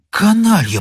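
high, voiced angry insult; understated narrated outburst; angry hiss. A narrator's reading. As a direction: throw the curse out indignantly. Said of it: "прошипел он".

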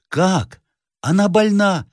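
high-pitched startled query; alarmed question. Acted. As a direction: ask in sudden worry, pitch jumping up. Master alarmed for his fiancée. (встревоженный вопрос)

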